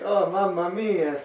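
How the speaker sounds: slow, high hammed-up Italian; Italian accent. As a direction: ham up an Italian pizza man. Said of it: "an Italian pizza shop".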